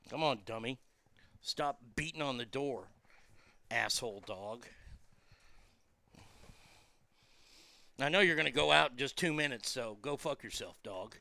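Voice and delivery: low, soft grumbling aside to a pesky dog; muttering at the dog. Real, not acted. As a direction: quietly scold the dog at the door, under the breath. Scolding the dog off-mic.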